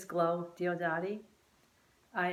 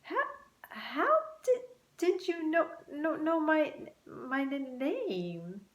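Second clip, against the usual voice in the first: stunned stammer; 8 semitones higher than usual. A narrator's reading. Luke stammering at the talking butterflies, astonished. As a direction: trip over every word in shock. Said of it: "Luke was really surprised and asked".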